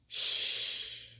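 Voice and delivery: long soft shush; hushing shh. Acted. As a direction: hush them gently to sleep. Hushing sleepy listener.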